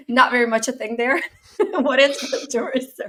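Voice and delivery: real amused laugh while asking. Real, not acted. Telling a friend about Polish service, laughing.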